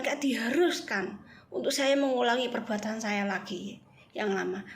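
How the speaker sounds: ordinary talk, nothing marked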